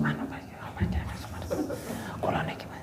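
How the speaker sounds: barely audible whisper, imitating a shy-voiced man; overly soft talker. Imitating a villager who talks too quietly, whispered. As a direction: mumble it almost without voice. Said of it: "Itu kalau ngomong".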